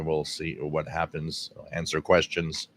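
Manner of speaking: normal talking voice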